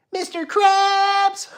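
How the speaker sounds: very high, loud, slow cartoon squeal; hammed-up squealing cartoon voice; cartoon character call. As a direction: holler it high, stretching the name out. SpongeBob impression after requested cartoon voices.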